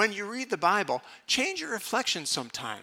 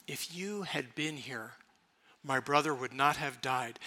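quiet sorrowful reproach; 3 semitones lower, 6 dB quieter than usual. Acted. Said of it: "the same phrase".

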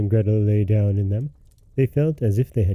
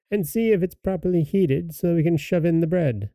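high, coaxing witch voice; 6 semitones higher than usual. A narrator's reading. Witch luring Gretel into the oven, high voice. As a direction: coax her in with a high witch voice. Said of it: "said the witch".

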